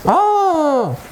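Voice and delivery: long high-pitched oh; mock wonder. Teacher reacting for young learners, mock wonder. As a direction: draw out a high ooh.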